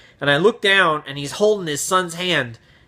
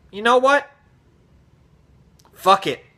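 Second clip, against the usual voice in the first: higher, mock defiant aside; reckless inner voice; 7 semitones higher than usual. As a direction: voice a filter giving up. Inner filter given a voice.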